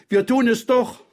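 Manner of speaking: put-on very high, insistent child; defiant girl. A narrator's reading. (trotzige, sehr hohe Mädchenstimme)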